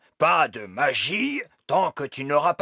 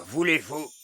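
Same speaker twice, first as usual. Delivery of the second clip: ordinary talk, nothing marked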